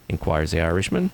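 ordinary talk, nothing marked

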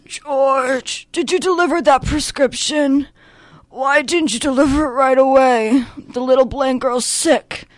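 put-on drunk, sad, grumpy man's raspy voice; Mister Gower. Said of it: "I go into this voice".

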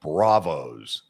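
each sound of "Bravos" spelled out slow and low; over enunciating. Shown as a demonstration. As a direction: mouth "Bravos" big and deliberate. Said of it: "Read my lips very closely".

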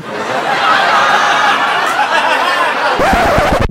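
a drawn-out high laugh; long laugh. Acted. Chuckling at flying commercial unrecognized, long laugh. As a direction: keep laughing at the idea.

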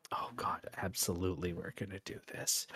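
gleeful whispered aside. Real, not acted. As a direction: hush it, excited.

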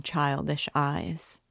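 usual speaking voice

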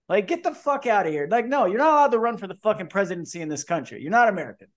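normal talking voice

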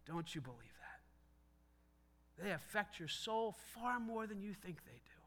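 slow, hushed whisper of warning; a secret warning whispered to the congregation. Real, not acted. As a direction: lean in and whisper it slowly. Preacher drops to a confiding warning.

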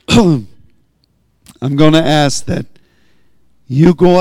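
preacher's voice worn rough from preaching; hoarse after a long sermon; evangelist voice. Real, not acted. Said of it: "getting evangelist voice".

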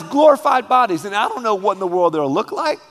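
ordinary talk, nothing marked

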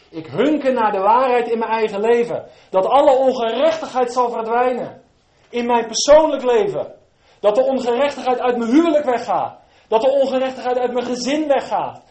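loud, high, fervent pulpit delivery; full-force preaching. Acted. Preacher crying out to the congregation, loud and fervent. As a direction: preach it out with all your fire.

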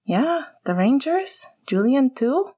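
high, eager rising questions; hopeful, delighted asking, pitch lifted high; lit up. A narrator's reading. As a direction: perk right up with a smile in the voice. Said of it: "Sirius's smile lit up".

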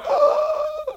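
sharp, high mock gasp of shock. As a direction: gasp like the lights just died. Preacher shocked when power goes out.

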